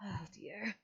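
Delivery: soft sighing "oh dear"; whispered sigh of dismay. Acted. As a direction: breathe it out, resigned. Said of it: "Brooke just kinda sighs".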